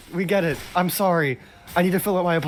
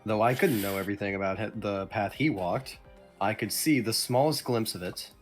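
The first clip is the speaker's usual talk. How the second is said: low, soft narrator read; story narration; 7 semitones lower, 7 dB quieter than usual. A narrator's reading. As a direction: narrate it quietly, pitched down. Reads the game narration.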